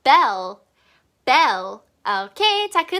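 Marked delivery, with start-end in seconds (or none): 0.0-1.8 "bell" ending on a light tongue-tip L; "bell" as a careful pronunciation model. Shown as a demonstration. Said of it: "혓바닥 끝을 살짝 물어주고".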